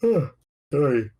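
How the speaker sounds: sighing yawn; sorry on a yawn. Real, not acted. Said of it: "I keep yawning".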